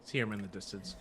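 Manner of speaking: normal talking voice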